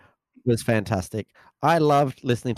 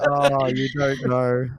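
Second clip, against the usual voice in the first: laughing; slower than usual. Retold or imitated. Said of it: "Yeah, like".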